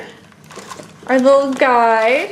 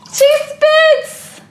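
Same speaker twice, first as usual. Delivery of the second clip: delighted exclamation; 11 semitones higher than usual. Real, not acted. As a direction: exclaim with glee. Toy doll spits.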